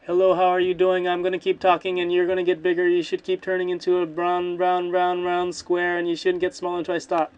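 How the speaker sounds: loud sing-song stream of words, no gaps; loud continuous noise. Shown as a demonstration. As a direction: keep talking loudly on one held pitch without a break. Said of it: "just sing and make long noises".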